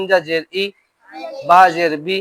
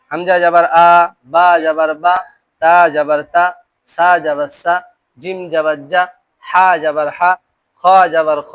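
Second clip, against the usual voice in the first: loud, measured letter-by-letter drill; recitation drill; 4 dB louder than usual. Shown as a demonstration. Teacher reciting zabar drill for pupils to repeat. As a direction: lead the class through the drill.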